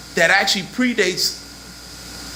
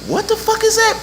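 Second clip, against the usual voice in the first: high-pitched baffled squeal; mock-shocked, very high question; retold incredulity. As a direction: voice leaps way up in disbelief. Retold shocked reaction to a passage.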